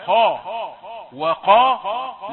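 خا and قا held long and heavy; heavy letters. Shown as a demonstration. Teacher isolating the heavy letters of خلق, loud and slow. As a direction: stretch خا and قا out full and heavy. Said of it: "اللام تأتي في الوسط مرققة".

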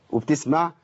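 usual speaking voice

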